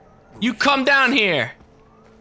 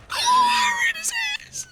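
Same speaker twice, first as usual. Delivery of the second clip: very high, slow squealing shriek; mock squeal; 3 dB quieter than usual. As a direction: squeal Right in his ass like a cartoon. Repeats the line squealing.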